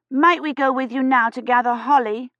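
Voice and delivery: put-on high, pleading little girl; a small girl impatient to go gather holly; eager child voice. Tired child asking priest.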